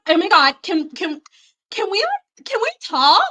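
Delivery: high, sugary plea to talk; mock sweet voice. Mimicking someone asking to talk nicely, high. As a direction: put on a fake-nice friendly tone. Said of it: "it's like".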